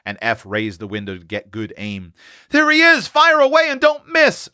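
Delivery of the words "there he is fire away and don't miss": put-on high, loud boyish yell; boy urging his brother to shoot the bear, crying out; excited cry. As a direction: egg him on from the dresser top. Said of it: "cried Seth".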